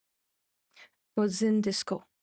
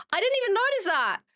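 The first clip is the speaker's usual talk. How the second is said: surprised; 9 semitones higher, faster than usual. Real, not acted. Surprised at stat.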